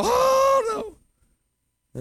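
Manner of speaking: hammed-up fretful parent; doting parent wail. Parent watching child fall, high mock wail.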